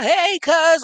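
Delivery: loud shouted mock greeting. Mocking a cop yelling hey cuz in front of McDonald's, loudly. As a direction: bellow it like the cop rolling up. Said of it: "you pull up talking about".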